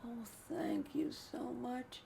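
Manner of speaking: soft, high, slow thanks; imitating a father's meek gratitude; retold hushed gratitude. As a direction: give thanks in a tiny voice.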